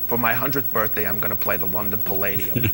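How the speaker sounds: a hint of a deadpan old comic; George Burns. Guest quotes George Burns at his ninety-seventh birthday, lightly voiced. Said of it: "And he says".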